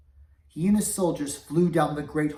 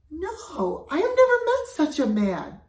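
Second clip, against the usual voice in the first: frightened denial; 13 semitones higher, 8 dB louder than usual. Acted. Accused cook denies charge.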